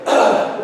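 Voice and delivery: short guttural mock groan of a sulky kid; caveman noise. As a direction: grunt it out like a grumpy child. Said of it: "we call it the caveman noise".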